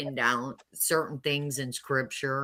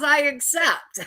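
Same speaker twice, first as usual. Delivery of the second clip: voicing God, amused; 10 semitones higher, 4 dB louder than usual. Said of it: "apparently God says".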